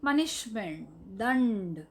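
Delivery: ordinary delivery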